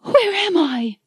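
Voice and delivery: high frightened woman. Acted. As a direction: ask it trembling with fear. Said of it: "Anne was trembling".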